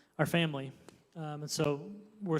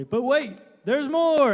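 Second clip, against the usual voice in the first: higher, louder TV pitchman hype; infomercial voice; 10 semitones higher, 10 dB louder, slower than usual. Pretend. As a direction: sell it like a late-night ad. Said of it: "this is where I get to use my infomercial voice".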